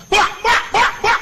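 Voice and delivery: loud, high "bah" bursts like blasts; mock explosions. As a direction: boom out each bomb blast. Mimicking bombs exploding.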